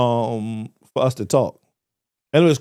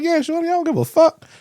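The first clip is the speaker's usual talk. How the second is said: careless friend voice. Retold or imitated.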